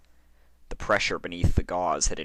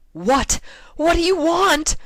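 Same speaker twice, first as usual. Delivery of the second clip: furious shout, much higher and louder; 12 dB louder than usual. A narrator's reading. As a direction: yell it at the fingers in outrage. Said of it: "she shouted at the fingers".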